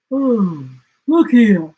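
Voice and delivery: a lazy yawn through words; sleepy yawning koala. Acted. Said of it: "he yawned".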